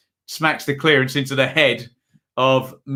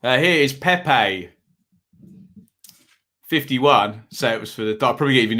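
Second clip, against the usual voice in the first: usual speaking voice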